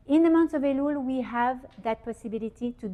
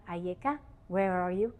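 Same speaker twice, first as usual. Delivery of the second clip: soft asking; 4 semitones lower, 6 dB quieter than usual. Acted. God asks Adam quietly.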